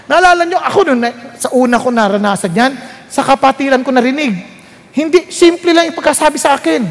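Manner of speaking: ordinary delivery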